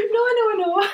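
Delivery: high, loud no no no through laughter; laughing. Retold or imitated. Telling the listener about clients, laughing through the words. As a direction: protest while cracking up.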